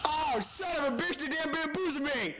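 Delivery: high shocked complaint; indignant outburst. Real, not acted. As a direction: cry out at being tricked. Tricked by the game.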